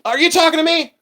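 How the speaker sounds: hammed-up indignant retort; mock offended. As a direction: play it outraged but jokey, high. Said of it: "and I'll go".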